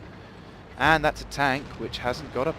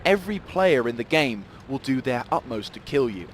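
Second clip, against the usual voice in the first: normal talking voice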